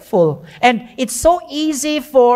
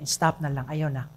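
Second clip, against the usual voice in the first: much softer and lower, a gentle stop; gentle rebuke; 9 semitones lower, 11 dB quieter than usual. Shown as a demonstration. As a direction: hush them kindly, almost under your breath. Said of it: "you have to gently tell that person".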